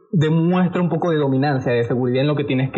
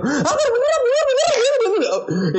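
imitating a friend bursting in, much higher; excited interruption. Friend Adrián interrupting in the car, imitated excited and much higher. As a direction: squeal it like an overexcited kid cutting in. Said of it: "todo excitado y diciendo".

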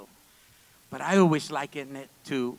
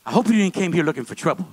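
confronting; 7 dB louder, faster than usual. Retold or imitated. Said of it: "Pastor Joe hammers me".

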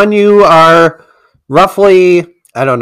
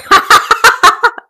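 high burst of laughter. Real, not acted. Laughs at own answer.

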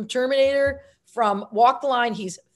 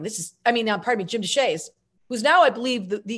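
ordinary delivery

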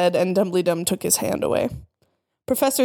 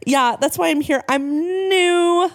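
higher, perky newcomer; happy; 9 semitones higher, slower than usual. A narrator's reading. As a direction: rise into a drawn-out, cheerful NEW. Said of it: "I smiled happily".

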